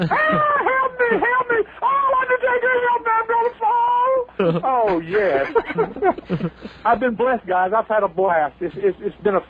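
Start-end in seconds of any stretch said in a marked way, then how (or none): 0.0-3.8 put-on scared little girl voice. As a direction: squeal it like a terrified damsel. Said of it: "I screamed like a little bitch".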